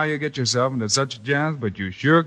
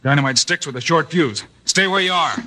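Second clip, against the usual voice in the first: usual speaking voice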